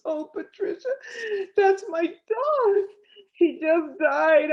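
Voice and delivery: high, strained, slow cry of grief; retold tearful cry. Grieving client tells the psychic her dog died, voice high and breaking. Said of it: "And she burst into tears".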